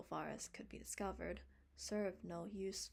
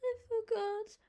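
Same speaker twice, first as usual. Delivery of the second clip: put-on high, loud little boy's whimper; a small boy whimpering his excuse; 13 dB louder than usual. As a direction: whine it like a caught child. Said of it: "whimpered Davy".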